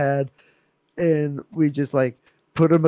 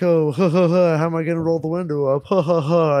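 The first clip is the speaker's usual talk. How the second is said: mock chuckling, quick and louder; sarcastic laugh; 5 semitones higher, 4 dB louder, faster than usual. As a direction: fake laugh through the sarcastic question. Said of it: "as a sarcastic joke".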